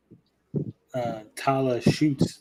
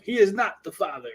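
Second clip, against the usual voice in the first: higher mock talk-show host reveal; Maury announcement; 7 semitones higher than usual. Mocking a talk-show host revealing the paternity result, higher. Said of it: "I was waiting for Maury to show up".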